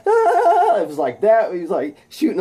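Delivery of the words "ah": very high, loud anime power scream; sustained high battle scream; Dragon Ball Z yell. Acted. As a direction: scream like you're firing an energy blast. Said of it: "Think of Dragon Ball Z when they be like".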